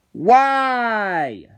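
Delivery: high loud drawn-out plea of disbelief; exasperated why. Real, not acted. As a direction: throw it up to the sky in despair. Exasperated at Patrick.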